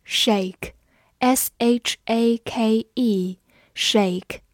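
very slow, letter by letter; slow spelling. Shown as a demonstration. Teacher spelling a word for the class. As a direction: spell it out slowly and clearly. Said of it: "字母A在这里发它本身的音A".

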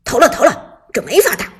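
loud fed-up shout; exasperated. Acted. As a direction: give up in a rage. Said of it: "许旺气急败坏地说".

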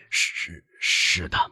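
put-on hushed, mournful old father; plaintive. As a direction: admit it piteously, voice nearly gone. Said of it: "老人凄凄哀哀地说".

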